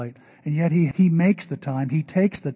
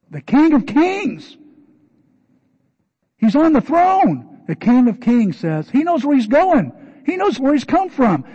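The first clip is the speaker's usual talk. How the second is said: slow, much higher and louder preaching proclamation; full-force preaching; 10 semitones higher, 8 dB louder than usual. Acted. Preaching the King of Kings.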